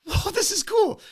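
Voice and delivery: high-pitched imitated audience excitement; impressed crowd. Audience reacting in retold concert story.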